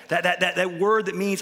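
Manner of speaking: ordinary delivery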